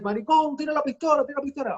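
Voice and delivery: high, heated Spanish threat; retelling his Spanish audition threat, heated; angry Spanish threat. Retold or imitated. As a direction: bark it at the guy in Spanish. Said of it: "I start speaking in Spanish".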